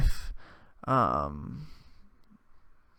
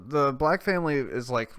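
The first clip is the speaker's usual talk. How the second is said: ordinary talk, nothing marked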